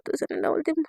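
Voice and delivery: usual speaking voice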